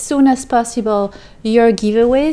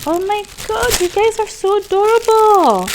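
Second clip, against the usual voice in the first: much higher, gushing; gushing squeal; 11 semitones higher, 4 dB louder than usual. Real, not acted. A crafter thanking her viewers, high gushing squeal. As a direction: squeal at how sweet they are. Opening gift.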